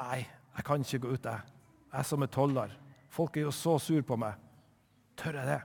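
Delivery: soft, hesitant self-talk; quiet and unsure, talking himself out of it; worried inner voice. Acted. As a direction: murmur your doubts to yourself. Tax collector doubts himself, inner voice.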